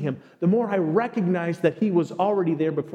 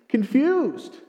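normal talking voice

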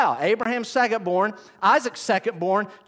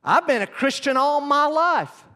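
mocking quote; 7 semitones higher than usual.

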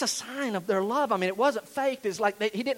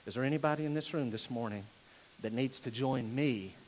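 normal talking voice

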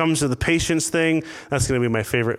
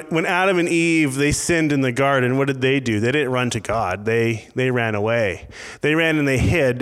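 normal talking voice

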